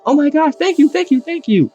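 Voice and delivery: high squealing thank-yous, mock overjoyed; gushing yandere girlfriend. Yandere girlfriend receives a chocolate heart, high mock squealing thanks. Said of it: "she would be crying, she would be screaming".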